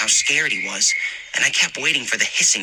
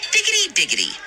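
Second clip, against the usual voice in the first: teasing singsong; faster than usual. A narrator's reading. Heather teasing again.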